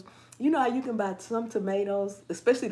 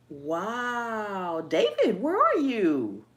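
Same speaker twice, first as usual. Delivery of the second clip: astonished; 4 dB louder, much slower than usual. Real, not acted. Host asking viewer David where he lives, astonished. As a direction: call it out in amazement, stretched. Exclaims at viewer's cold weather report.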